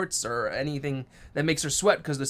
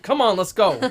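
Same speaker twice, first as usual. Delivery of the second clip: impatient call; 7 semitones higher, 7 dB louder than usual. Retold or imitated. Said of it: "I'm like".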